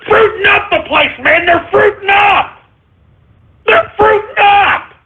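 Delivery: mock-furious radio host yell; outraged yell. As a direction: yell it like you can't believe it.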